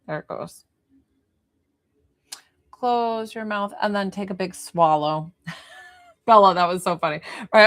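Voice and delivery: ordinary delivery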